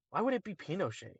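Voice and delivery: puzzled objection. Real, not acted. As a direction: question the guess quickly, without raising your voice. Questions a wrong guess.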